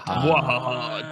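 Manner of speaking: slow evil cackle; drawn-out villain laugh; mad scientist. Pretend.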